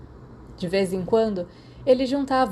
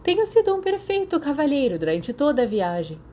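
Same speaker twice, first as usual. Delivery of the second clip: higher, prissy gentleman voice; mock prim; 7 semitones higher than usual. A narrator's reading. Sirius protests his innocence, hammed-up prim and high. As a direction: play the wounded gentleman.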